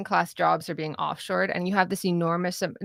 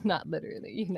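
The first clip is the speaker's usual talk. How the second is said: ordinary delivery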